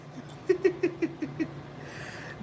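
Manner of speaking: airy giggle; breathy high laugh. Real, not acted. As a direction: wheeze of a laugh. Laughing after retelling.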